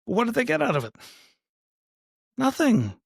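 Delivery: high-pitched rhetorical question and answer; mock incredulous. Host mocking what they got out of it, high-pitched incredulity. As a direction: pitch it way up, drawing out the mockery.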